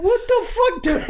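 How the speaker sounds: very high-pitched outraged cry; hammed-up "what the hell" outburst; mock outraged yell. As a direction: imitate a friend exploding in disbelief. Imitates big friend splashed with beer.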